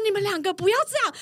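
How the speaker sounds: quick, high mock plea to calm down; lightly voiced male coworker begging them to stop; coworker pleading. Coworker begs the two fighters to calm down, fast and high. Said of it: "我那个男同事就说".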